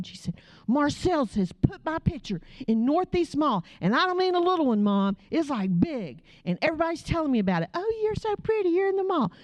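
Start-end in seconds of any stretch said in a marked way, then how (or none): 0.7-7.7 indignant retold rant, pitched up; a daughter's high, worked-up complaint; imitating mad daughter. Daughter complains to mom about her mall picture, mock mad. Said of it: "and she is mad". 7.7-9.3 very high, mock-gushing compliment; imitating gushing admirers. Daughter mimics people praising her mall picture, mock gushing.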